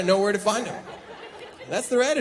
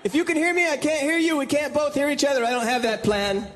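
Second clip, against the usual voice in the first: much higher, straining caller; phone caller; 7 semitones higher than usual. Acted. Comic playing a caller on a dropped call, much higher. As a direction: strain to be heard on a bad line.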